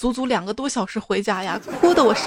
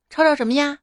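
higher, louder, irritated comeback; mock-brave retort; 5 semitones higher, 4 dB louder, faster than usual. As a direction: act out a kid boldly telling mum off. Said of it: "我鼓起勇气说". (鼓起勇气不耐烦地顶嘴)

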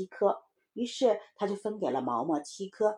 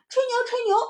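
put-on very high, loud kid's jeer; teasing little child; 6 dB louder than usual. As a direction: chant a taunt at your playmate. Child taunts boasting playmate. (小孩尖着嗓子高声起哄)

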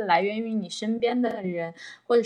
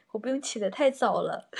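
ordinary talk, nothing marked

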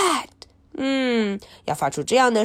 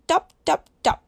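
1 raindrops dripping; 7 semitones higher, much faster than usual. Pretend. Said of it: "它可以像雨的声音".

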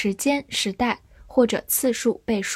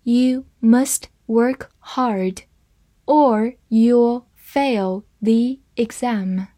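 very slow, careful read-through; deliberate slow model sentence; 3 dB louder, much slower than usual. Shown as a demonstration. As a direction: read it slowly for the class. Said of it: "好，慢慢来读。".

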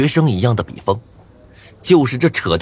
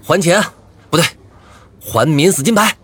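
angry demand; 7 semitones higher than usual. Acted. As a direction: bark out the demand. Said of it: "我怒极反笑".

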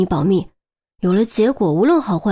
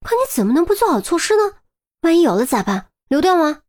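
exasperated telling-off with high peaks; scolding lecture; 7 semitones higher than usual. A narrator's reading. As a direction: scold out of concern. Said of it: "一副教训的口吻".

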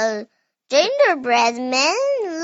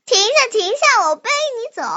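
pig's coaxing call; 7 semitones higher, faster than usual. Acted. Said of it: "你说那应该怎么喊".

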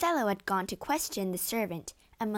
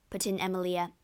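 usual speaking voice

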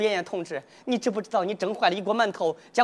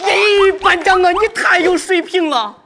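loud, slow, fawning praise; gushing flattery; 5 semitones higher, 5 dB louder, slower than usual. Acted. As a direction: lay the flattery on thick and loud. Fawning over squad leader. (对班长大声拍马屁)

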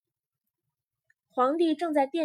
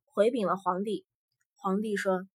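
ordinary delivery

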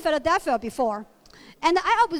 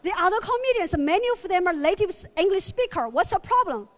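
indignant surprise; 3 semitones higher than usual. Retold or imitated. Comedian pushes back at her friend, mock indignant. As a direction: huff it out like you're offended. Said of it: "I was like".

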